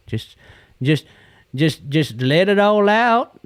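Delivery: slow, high, stretched-out encouragement; slow drawn-out. Real, not acted. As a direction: draw it out slowly, rising at the end. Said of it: "Clyde used to say".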